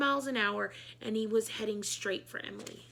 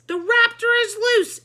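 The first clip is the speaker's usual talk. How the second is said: high loud panicked shout; 12 semitones higher, 13 dB louder than usual. A narrator's reading. Ryan warning the whole gym, a high alarmed shout. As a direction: yell the alarm. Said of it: "shouted Ryan".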